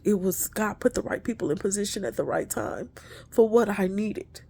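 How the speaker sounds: normal talking voice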